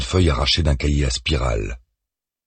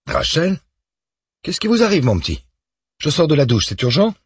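brisk superior surprised by a dawn call; a boss fresh out of the shower; 12 semitones higher than usual. A narrator's reading. Boss answering the phone.

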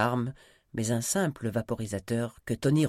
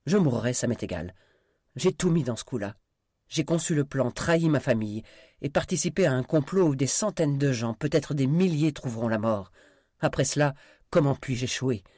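louder, driven confession; desperate defiance; 4 semitones higher, 6 dB louder than usual. Acted. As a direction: pour it out with nothing left to lose. Desperate man ready to die.

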